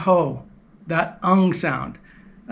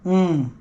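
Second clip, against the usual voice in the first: hummed nasal mm. Shown as a demonstration. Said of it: "that's why we call it the nasal sound".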